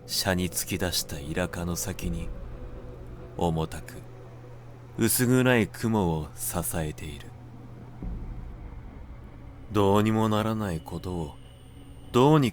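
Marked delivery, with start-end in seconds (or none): none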